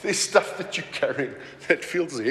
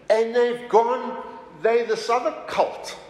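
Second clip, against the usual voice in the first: slow mock outrage, pitched up; mock criticism; 7 semitones higher than usual. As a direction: imitate a disapproving Roman critic. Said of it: "historical documents that criticize".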